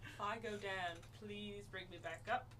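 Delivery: high, pleading character voice. Acted. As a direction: beg to be brought back up.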